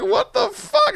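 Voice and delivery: high squeal breaking into laughter; mock horror. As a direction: act out the scare, very high. Repeats his scare for laughs.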